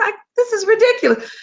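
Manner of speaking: high-pitched, indignant retold complaint; acted-out exasperation; exasperated protest. Retelling her complaint to her husband.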